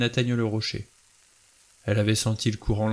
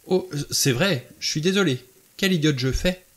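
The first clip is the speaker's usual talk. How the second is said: higher-pitched self-reproaching apology; flustered apology; 6 semitones higher, 3 dB louder than usual. A narrator's reading. Vera apologises to Emily over toast, flustered. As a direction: apologise in dismay, pitch raised.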